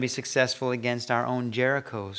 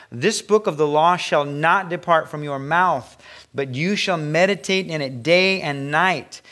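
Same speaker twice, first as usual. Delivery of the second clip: loud, raised reading of God's words; scripture reading; 3 semitones higher, 4 dB louder than usual. Acted. Pastor reading God's command to the congregation, proclaiming. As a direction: proclaim the verse with full force.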